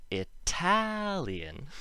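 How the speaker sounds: "Italian" with "TAL" high, loud and long; slow, exaggerated second-syllable stress on "Italian"; emphasized second syllable. Shown as a demonstration. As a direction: punch and stretch the middle of "Italian", with a true T. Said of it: "it's the other way around".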